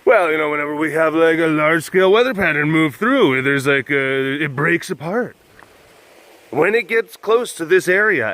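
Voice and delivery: loud, high mocking know-it-all voice; mocking wife's theory. Mocking his wife's weather theory, loud and high. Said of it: "basically saying".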